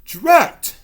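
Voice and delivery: high, loud Drat; cross exclamation. Acted. Ghost cursing the stuck pumpkin, annoyed. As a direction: blurt it out, frustrated. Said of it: "said the ghost".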